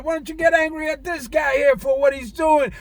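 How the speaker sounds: ordinary talk, nothing marked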